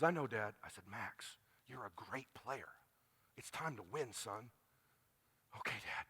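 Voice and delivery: very soft, low near-whisper; hushed intimacy. Retold or imitated. Dad quietly telling his son to win, hushed. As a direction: drop to a private, earnest whisper.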